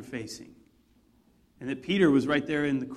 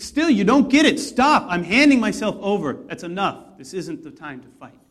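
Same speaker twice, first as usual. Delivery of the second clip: a raised, urgent halt; Jesus commanding; 3 semitones higher, 8 dB louder than usual. Retold or imitated. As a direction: stop them with authority. Jesus voiced, stopping the fight.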